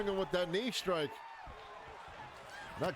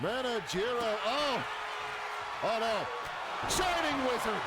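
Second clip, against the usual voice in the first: excited; 5 semitones higher than usual. Real, not acted. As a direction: call the finisher with rising excitement. Finishing move in live commentary.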